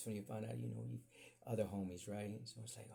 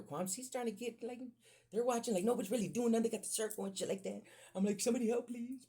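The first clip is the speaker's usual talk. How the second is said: higher and louder, urgent; worked-up retelling; 10 semitones higher, 4 dB louder, faster than usual. Retold or imitated. Retelling the schoolyard fight, high urgent pitch. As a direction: build the panic of the fight.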